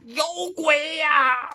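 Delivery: 拖长的高声惊叫; 慢慢拉长，吓得喊出来; 大喊有鬼. Acted. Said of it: "他也大喊一声". (拉长声音惊叫有鬼)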